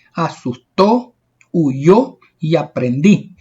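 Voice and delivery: ocurrió, pateó, terminó and the rest, each swelling on its last syllable; drawn-out final stress, as in saltarín, asustó, aprendí. Shown as a demonstration. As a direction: list them one by one, leaning on the end as in trepó, portón. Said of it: "la mayor fuerza de voz la llevan en la última sílaba".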